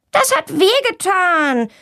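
high, slow cross complaint; scolding. A narrator's reading. Girl scolds Tommy back, slow and cross. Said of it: "schimpft Valentina zurück".

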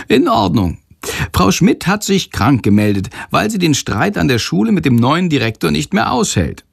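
high, lively storyteller figure; chatty Sándor, obliging. A narrator's reading. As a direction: recount it eagerly in a high character voice. Sándor recounts the conversation. (hoch und bereitwillig erzählend)

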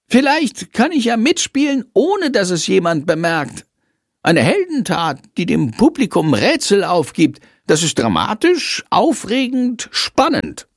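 high, excited small creature voice, building; a tiny bat talking himself into glory; high voiced bat pleading to join the play; eager little bat hero. Bat character dreams of a scene.

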